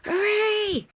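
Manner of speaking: put-on high, loud kids' "Hooray!"; excited cheer. A narrator's reading. Kids cheering for Dad's game, excited. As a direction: shout it with glee.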